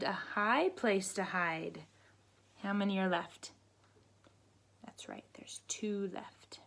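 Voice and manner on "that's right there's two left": hushed whispered praise. Real, not acted. Teacher whispering the answer to kids, whispering.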